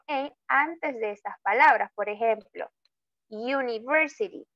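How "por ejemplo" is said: normal talking voice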